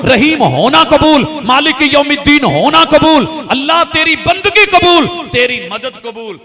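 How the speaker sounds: loud, driving litany of acceptance; full-force preaching. Real, not acted. Preacher declares to Allah his acceptance, impassioned full-force preaching. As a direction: proclaim each phrase with rising force.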